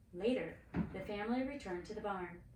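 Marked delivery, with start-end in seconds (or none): none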